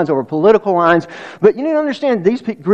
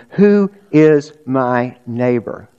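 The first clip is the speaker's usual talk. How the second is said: slow emphatic question; 4 semitones lower, slower than usual. Acted. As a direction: stretch "Who is my neighbor?" out slowly, leaning on "who". Preacher repeats key question.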